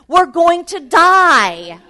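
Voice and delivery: loud, high, slow wail; despairing shout. A narrator's reading. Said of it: "quite dumbfounded and asked".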